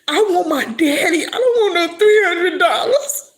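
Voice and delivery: high, loud crying plea; wailing through tears for her daddy; sobbing. Real, not acted. Daughter crying that she wants her father back, sobbing.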